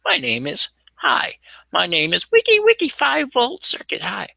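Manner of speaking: silly whisper voice. Pretend. Silly made-up introduction.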